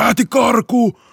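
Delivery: retold warning shout. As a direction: yell to your partner down the hall. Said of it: "Mä huusin".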